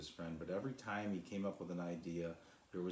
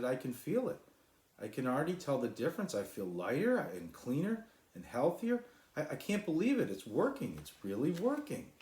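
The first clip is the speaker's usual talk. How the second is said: higher, louder, rushing joy; overjoyed; 8 semitones higher, 6 dB louder than usual. A narrator's reading. Cloud feeling lighter, high and joyful. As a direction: let the delight spill out.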